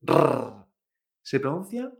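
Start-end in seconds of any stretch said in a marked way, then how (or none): 0.0-0.7 R as a long rolled trill; R con vibración. Shown as a demonstration. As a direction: show the Spanish trilled R. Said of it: "no se pronuncia con esta vibración". (R vibrante, bien marcada)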